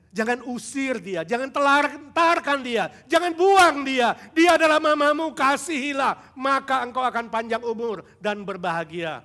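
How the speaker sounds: put-on preacher thundering at neglectful children; preaching building to a shout. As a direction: build to a pleading roar.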